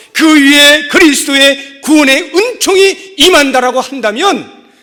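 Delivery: loud, high-pitched pulpit proclamation; full-force preaching. Acted. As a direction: build to a shouted sermon climax. (목청 높여 외치는 설교)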